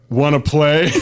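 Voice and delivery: put-on creepy old man voice, laughing; lightly voiced creepy old man; leering wheezy old man. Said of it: "Creepy old man voice".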